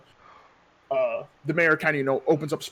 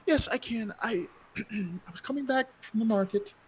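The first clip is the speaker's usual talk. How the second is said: a lightly voiced wife, higher and softer, near tears; a hint of a tearful widow; grieving widow; 5 semitones higher, 5 dB quieter, slower than usual. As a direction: tell it slowly as if about to break.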